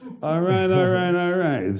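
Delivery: high, slow drawled catchphrase; Matthew McConaughey. Retold or imitated. Hosts mocking the actor's catchphrase, slow drawl. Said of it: "Matthew McConaughey".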